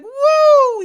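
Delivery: put-on a boy's cheer as he wins; excited whoop. Kamil cheering loudly after his name is called. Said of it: "he's like".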